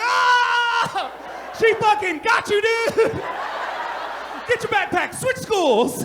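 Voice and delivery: very high, very loud hype yell; hyped shout. Retold or imitated. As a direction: scream it like you're cheering a knockout. Hyping a student's roast.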